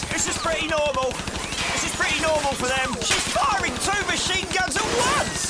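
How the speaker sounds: high, panicked and fast; alarmed. Acted. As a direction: shout over gunfire in panic. Under machine gun fire.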